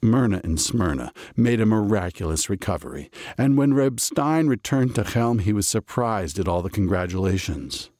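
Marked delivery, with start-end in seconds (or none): none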